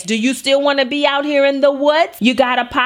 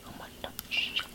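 hissed warning under the breath; sneaky whispered threat to a stranger's kid; 24 dB quieter than usual. Retold or imitated. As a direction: whisper it so the mom won't hear.

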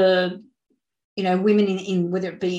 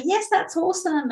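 much higher, bright praise; cheering; 10 semitones higher than usual. Retold or imitated. Praising wins in the group, excited cheer. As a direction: cheer a group member's win. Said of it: "amazing to go".

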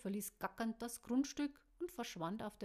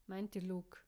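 plain reading voice

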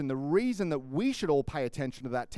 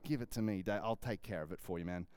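hushed offer; 7 semitones lower, 9 dB quieter than usual. Retold or imitated. Fugitive quietly offering to kill Saul.

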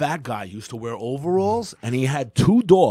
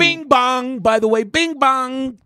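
high, slow sing-song bing bong; goofy bing bong; 12 semitones higher, 4 dB louder, slower than usual. Acted. Joking to his cohost with a high sing-song bing bong. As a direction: crow it out like a silly jingle.

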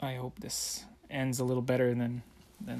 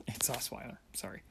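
whispered; 2 semitones lower, 7 dB quieter than usual. Real, not acted. Dismissive aside.

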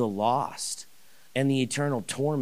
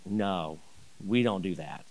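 lower, softer, curt neighbor's no; gruff refusal; 5 semitones lower, 5 dB quieter than usual. Retold or imitated. Neighbor Larry refusing church, low and curt. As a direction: shut the door on him, low.

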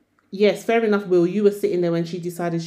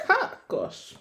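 laughing; 12 semitones higher, 6 dB quieter than usual. Real, not acted. Laughs again.

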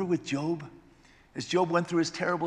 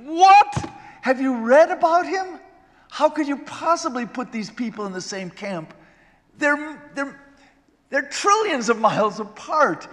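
incredulous outburst; 7 semitones higher, 8 dB louder than usual. Retold or imitated. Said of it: "I think".

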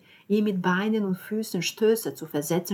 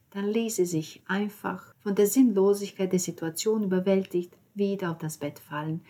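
plain reading voice

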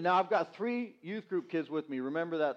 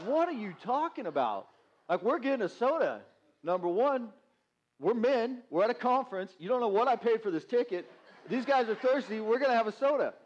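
indignant protest; 6 semitones higher than usual. Retold or imitated. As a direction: play up the outrage at being refused a soda. Pastor retells arguing with soda lady.

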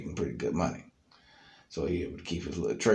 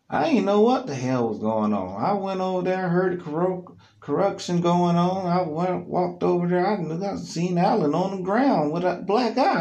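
higher, louder retelling as Tim; Tim's voice; 12 semitones higher, 7 dB louder than usual. Retold or imitated. Friend Tim telling what he saw, higher louder voice. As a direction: tell it as excited Tim. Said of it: "Tim's side of the story was".

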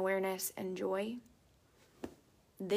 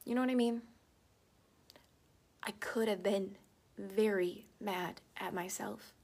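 ordinary talk

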